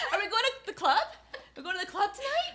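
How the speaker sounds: high, loud, fast clubbing squeal; hammed-up party girl; giddy mock club invite; mock party excitement.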